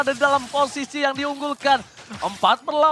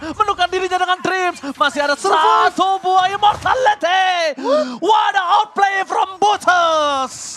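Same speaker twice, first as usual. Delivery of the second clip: loud, high, drawn-out hype call; hype shout; 7 semitones higher, 4 dB louder, slower than usual. Real, not acted. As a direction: scream the outplay to the crowd. Caster calls a teamfight.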